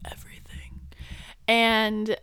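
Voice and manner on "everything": unvoiced whispered repeat. Real, not acted. Friend whispering emphasis about her mom's complaints. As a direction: breathe the word out, no voice.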